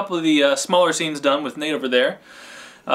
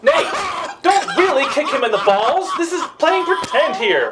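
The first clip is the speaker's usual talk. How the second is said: laughing; 13 semitones higher, slower than usual. Real, not acted. Director laughing at the actors.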